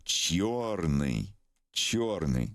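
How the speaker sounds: "чёрный" spoken the Russian way; Russian word. Shown as a demonstration. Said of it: "this would be".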